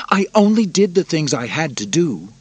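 high, loud self-justifying voice; defensive emperor. Acted. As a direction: insist like a spoilt ruler. Narrator voices Commodus.

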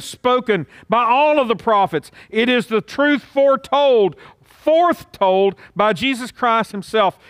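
high-pitched, driving sermon climax; forceful preaching. Real, not acted. Preacher urges everyone to choose whom to serve, forceful preaching. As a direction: proclaim it with ringing emphasis on key words.

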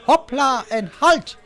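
high, loud, slow circus call; a ringmaster lady commanding her troupe; showy command. A narrator's reading. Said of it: "riep de dame".

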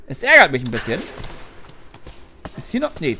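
ordinary talk, nothing marked

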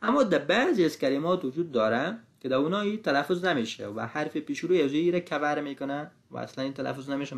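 ordinary talk, nothing marked

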